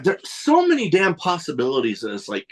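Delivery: ordinary talk, nothing marked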